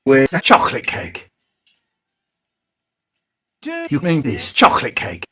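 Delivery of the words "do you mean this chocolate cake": ordinary talk, nothing marked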